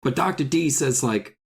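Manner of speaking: ordinary talk, nothing marked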